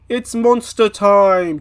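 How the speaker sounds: loud, slow, drawn-out; hyped announcer. Pretend. Vlogger introducing the monster fight, hyped. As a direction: announce it like a show.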